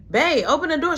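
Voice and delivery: high, loud call at the door; calling through door. Retold or imitated.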